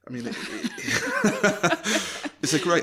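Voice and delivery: laughing while talking; words pushed through a giggle. Real, not acted. Laughing about the actor.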